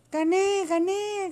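high, exultant cry; triumphant shout. A narrator's reading. Pili celebrates winning the race, shouting. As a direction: crow your victory up high. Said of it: "gritó Pili".

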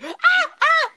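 a sudden high mock scream, ah ah; imitated scream. Friend retelling the car ride, imitating her scream. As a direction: shriek like a startled girl in the car. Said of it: "Then she starts screaming".

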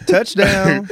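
blurting it out through a high laugh; laughing joke. Real, not acted. Teasing a friend about sports, laughing. Joking that tennis has touchdowns.

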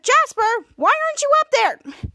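high, loud mock telling-off; mock scolding. Acted scolding of Jasper.

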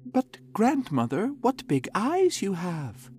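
put-on high, wondering child; curious little girl. A narrator's reading. Girl questions wolf.

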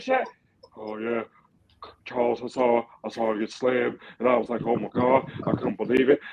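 Charles Barkley impression. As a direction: ramble like Charles Barkley on a TV panel. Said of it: "my best Charles Barkley impression".

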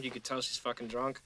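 ordinary talk, nothing marked

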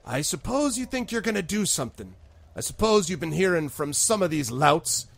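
disapproving constable. Acted. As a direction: needle the strangers as a disapproving constable. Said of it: "and she says".

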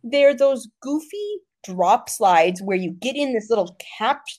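ordinary delivery

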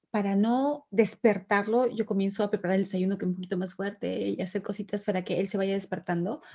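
normal talking voice

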